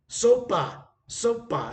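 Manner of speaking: high, loud Sopa, sopa with falling ends; waitress announcing soup. Retold or imitated. Waitress setting down the soup, loud and high. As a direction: bring the bowl and sing out Sopa.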